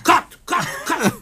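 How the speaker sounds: high, laughing cut; mock panicked actor. Confused actor answering the director, high and laughing. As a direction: squeak out cut while laughing. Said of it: "I said".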